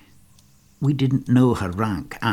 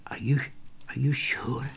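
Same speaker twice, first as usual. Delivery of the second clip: hushed, faltering near-whisper; quiver in voice; 3 semitones higher, 8 dB quieter than usual. Acted. David asks Steve if he is sure, voice quivering. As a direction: ask softly, faltering as if afraid of the answer. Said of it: "with a quiver in his voice".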